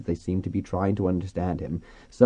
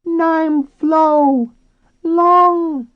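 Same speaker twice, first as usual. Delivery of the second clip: very high, loud dolphin squeal; talking dolphin; 10 dB louder, much slower than usual. A narrator's reading. Dolphin character introducing itself.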